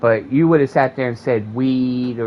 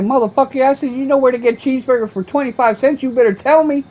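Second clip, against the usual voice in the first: much higher, louder, fast taunting; mock yell; 13 semitones higher, 4 dB louder than usual. To the bum at McDonald's, a loud mocking taunt. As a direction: ridicule the bum, half-shouting.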